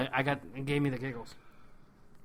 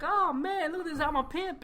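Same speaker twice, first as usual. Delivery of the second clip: high-pitched playful strutting voice; mock show-off; 12 semitones higher than usual. Character showing off the hat he puts on, mock boastful. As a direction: crow it like a hammed-up pimp.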